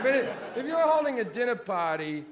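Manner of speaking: ordinary delivery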